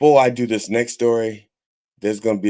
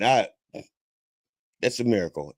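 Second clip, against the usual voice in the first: usual speaking voice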